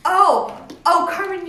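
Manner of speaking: retold realisation. Retold sudden realisation on meeting mom.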